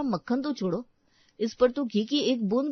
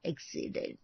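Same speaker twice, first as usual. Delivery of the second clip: breathless whisper, much softer; barely able to say it; a hushed, broken whisper; whispered grief; 5 semitones lower, 12 dB quieter than usual. Acted. Husband breaks grim news.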